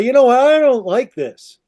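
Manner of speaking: slowing into a firm, high complaint; acted objection. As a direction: play a woman who has had enough. Eve begins to object.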